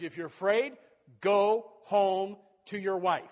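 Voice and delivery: loud, slow preaching cry; preached shout. Acted. Gideon calls out to the fearful troops, loud and slow. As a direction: bellow it slowly like Gideon. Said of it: "Gideon preached".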